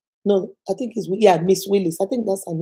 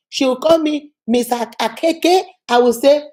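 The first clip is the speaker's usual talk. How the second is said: normal talking voice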